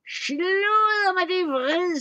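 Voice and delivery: high, loud, slow troll scream. A narrator's reading. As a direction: scream it in fury. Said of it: "skreg trolden".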